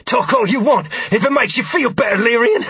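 words forced through clenched teeth; through gritted teeth. A narrator's reading. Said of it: "replied to me through gritted teeth".

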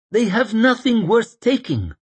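put-on high, anxious young man; earnest young man voice. Sufin voiced.

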